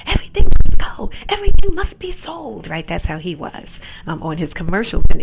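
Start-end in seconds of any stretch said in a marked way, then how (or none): 0.1-2.7 much higher, louder, fast pitchman yell; Crazy Eddie pitch. Retold or imitated. Quoting the electronics store ad man to the live audience in a high, loud pitchman yell. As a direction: blast it like a cheap TV commercial huckster. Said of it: "He was like".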